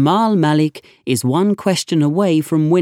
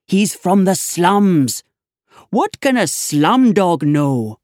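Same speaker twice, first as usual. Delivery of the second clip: barely contained anger; 6 semitones higher than usual. Acted. Inspector ranting to constable about the slum boy, high furious rant. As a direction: let the rage spill out over every word. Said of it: "could hardly contain his anger".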